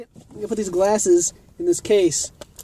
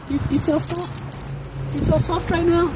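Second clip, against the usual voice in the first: dog-talk voice; 9 semitones higher, slower than usual. Real, not acted. Owner coos at soft dog.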